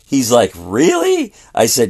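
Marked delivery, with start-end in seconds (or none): 0.6-1.3 high-pitched questioning disbelief; retold astonished question, rising high; surprised. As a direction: imitate an amazed listener, voice jumping up. Young man's retold surprised reply.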